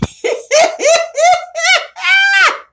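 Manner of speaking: high shrieking laugh; real high shriek of laughter. Real, not acted. Cracking up at herself.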